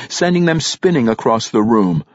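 ordinary delivery

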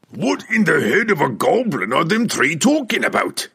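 high, loud battle-crazed dwarf; demanding dwarf. A narrator's reading. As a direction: bellow it as a rough-and-ready dwarf demanding answers. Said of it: "Pwent demanded of Cobble".

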